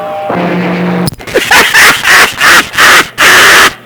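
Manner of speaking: a real, drawn-out laugh; long laugh. Real, not acted. Radio buddy laughing at a friend's confession, long laugh.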